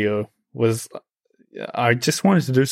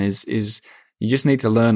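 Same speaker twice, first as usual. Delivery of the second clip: ordinary delivery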